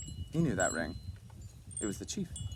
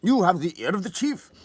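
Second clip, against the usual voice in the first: loud, higher, cutting him off; respectful brusqueness; 7 semitones higher, 7 dB louder than usual. A narrator's reading. Cachalin cuts off his colleague's modest refusal, brusque and louder. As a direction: brush the objection aside, politely but firm. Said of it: "cut him short with a respectful brusqueness".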